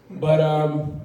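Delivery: ordinary talk, nothing marked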